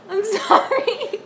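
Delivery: high, slow sorry through laughter; laughing apology. Real, not acted. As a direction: giggle the apology out. Laughing apology after being scolded.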